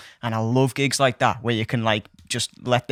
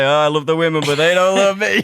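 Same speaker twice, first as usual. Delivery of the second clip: laughing impression of a tipsy uncle, louder; drunk uncle; 4 semitones higher, 5 dB louder than usual. Nephew imitating his uncle at Christmas, laughing. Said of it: "And he's like".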